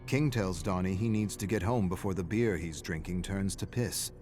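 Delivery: ordinary delivery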